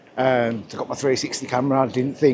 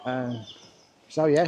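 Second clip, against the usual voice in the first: ordinary talk, nothing marked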